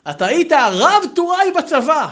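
loud, high, drawn-out announcement; big hammed-up declaration; mock proclamation. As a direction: proclaim it slowly at the top of the voice. Retelling a teasing call of a rank.

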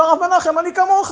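high-pitched retold protest; insistent protest. Officer answering the rabbi's question, insistent protest. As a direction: plead your case, voice pitched right up.

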